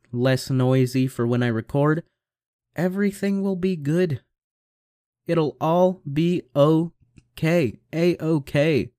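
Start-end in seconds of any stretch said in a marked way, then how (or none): 2.8-8.9 slow, higher sing-song "okay"; drawn-out, higher reassurance. Real, not acted. Telling himself it'll work out, slow and higher.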